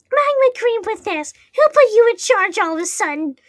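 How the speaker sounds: high, loud indignant retort; angry snap. Acted. Herobrine snapping up at the narrator over who's in charge, angry snap. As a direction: snap it back, pitched right up. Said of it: "Herobrine snaps up at me".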